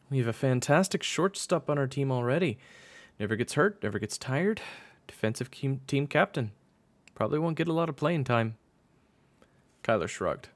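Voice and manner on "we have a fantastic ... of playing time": high-pitched coach voice; discouraging coach. Acted. Coach warns boy about playing time, discouraging high voice. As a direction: coach talking up his shortstop, raised pitch.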